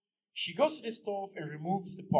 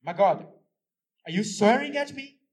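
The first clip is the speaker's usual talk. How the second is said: much louder and higher, offended; indignant; 5 semitones higher, 8 dB louder than usual. Acted. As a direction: challenge him. Wife confronts swearing husband.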